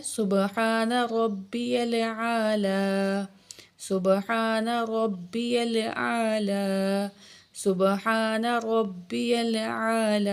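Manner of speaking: slow, measured tasbih; prayer recitation. Shown as a demonstration. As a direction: recite it three times calmly. Sajda tasbih thrice.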